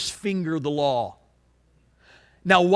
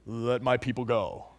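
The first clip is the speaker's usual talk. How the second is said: lower, weighty movie-Moses voice; Charlton Heston; 6 semitones lower, 5 dB quieter than usual. Retold or imitated. Preacher mimicking Charlton Heston as Moses to the congregation, low and grand. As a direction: intone it like an epic film Moses. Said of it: "with Charlton Heston".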